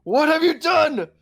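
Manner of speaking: angry gallery owner. Acted. GM voices angry exhibition owner.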